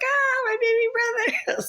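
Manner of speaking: very high drawn-out mock scream; retold screaming. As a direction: reenact a panicked wail for a brother. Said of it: "I started screaming really loud".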